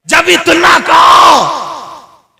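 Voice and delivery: very high, slow, long-held cry; a drawn-out wail from the pulpit; preaching shout. Acted. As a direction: stretch the words out at full force. Preacher's climax.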